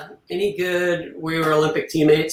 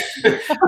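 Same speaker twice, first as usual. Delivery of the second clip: breathy burst of laughter. Real, not acted. Laughing at lube job.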